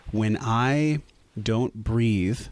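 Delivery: ordinary delivery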